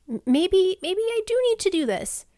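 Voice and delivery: hesitant inner self. Retold or imitated. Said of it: "I'm like".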